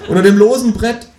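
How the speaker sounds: high, loud confession; desperate scream. A narrator's reading. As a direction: blurt it out in loud despair. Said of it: "schrei ich verzweifelt".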